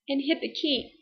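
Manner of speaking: normal talking voice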